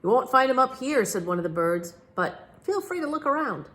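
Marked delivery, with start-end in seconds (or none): 0.0-1.0 higher, louder bird voice; chirpy birds. A narrator's reading. Birds greeting the flying detective, chirpy. 2.2-3.7 high, light bird voice; friendly bird. A narrator's reading. Bird inviting the detective to look around, breezy.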